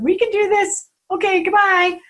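high, loud, upbeat sign-off; put-on confidence. Two frightened sisters ending their call, trying to sound awesome. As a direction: overplay the pep to cover the doubt. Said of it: "we're just trying to be awesome".

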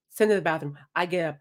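ordinary talk

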